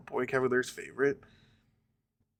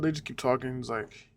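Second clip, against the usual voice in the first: ordinary talk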